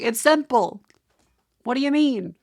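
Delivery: high, loud mocking tone; mocking chat voice. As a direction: mimic chat as if it were easy. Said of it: "You guys like".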